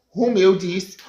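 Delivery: ordinary delivery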